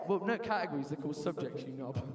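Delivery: ordinary delivery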